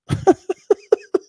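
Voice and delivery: high, rapid chuckle; a quick run of voiceless giggles. Real, not acted. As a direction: laugh to yourself. Laughs after his own remark.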